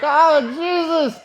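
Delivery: loud, high, slow drawn-out groan of disbelief; overwhelmed cry. Retold or imitated. Man pinned under her crying out, slow and loud. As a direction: let it out like you can't believe what's happening.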